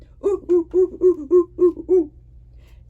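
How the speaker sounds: loud high hooting laugh; a happy gorilla hoot. Acted. Reading the flannel board, gorilla calling out, loud hoot.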